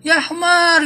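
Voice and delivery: menirukan makian dengan nada tinggi; pura-pura memaki, suara naik tinggi; makian kasar. Retold or imitated. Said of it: "Mereka kalau ngomongnya kasar". (menirukan umpatan kasar bernada tinggi)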